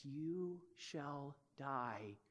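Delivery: very slow and soft; inner voice of conscience. Acted. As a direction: let it creep in, slow and hushed. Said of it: "The conscience that says".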